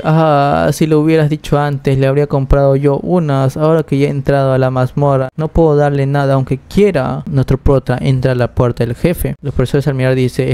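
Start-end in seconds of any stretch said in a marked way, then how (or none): none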